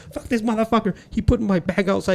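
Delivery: high-pitched imitated whining protest; mock indignant complaint. Comic mimics the bomber finding his bag thrown out, mock indignant.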